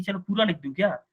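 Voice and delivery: ordinary talk, nothing marked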